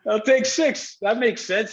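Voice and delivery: high-pitched retold reply through laughter; laughing. Player retelling accepting number six, laughing. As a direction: give in while cracking up.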